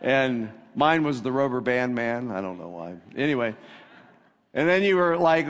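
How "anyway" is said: usual speaking voice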